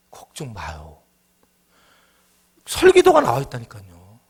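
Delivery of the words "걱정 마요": whisper. Acted. Reassuring the worried congregation.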